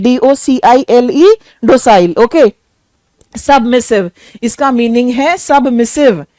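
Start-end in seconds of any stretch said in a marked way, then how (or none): 0.0-1.3 डीओसीआईएलई spelled letter by letter. Shown as a demonstration. Teacher to students, spelling डीओसीआईएलई. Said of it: "वो होता है हमारा डोसाइल".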